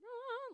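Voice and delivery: high, swelling "ah"; sudden high sung swell; swelling orchestral imitation. Imitates swelling soundtrack music.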